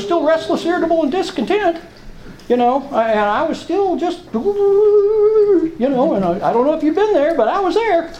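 fighting tears, words rising and straining; voice breaking. Real, not acted. Telling fellow members about his restless days, voice breaking. Emotional confession, voice breaking high.

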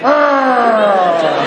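long, high, loud yell falling away; mock scream. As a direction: let out a sudden crazed holler. Acts out his crazed reaction.

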